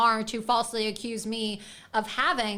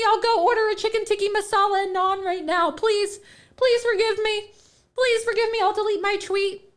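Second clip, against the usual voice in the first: put-on high, loud, pleading whine of a scared girl; mock groveling apology; 7 semitones higher, 4 dB louder than usual. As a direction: beg forgiveness in a hammed-up high voice. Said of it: "I'm not going to sit there and say".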